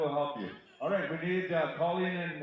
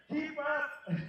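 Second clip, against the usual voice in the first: high laughing call, teasing a slow couple; a playful high shout through laughter; laughing tease; 12 semitones higher than usual. Real, not acted. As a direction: laugh it out as you hurry them along.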